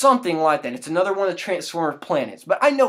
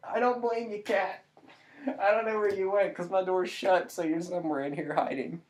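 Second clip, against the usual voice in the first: softer, gentle coaxing; soft to cat; 7 dB quieter than usual. Real, not acted. Owner talking softly to the hidden cat. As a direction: talk softly to a hiding pet.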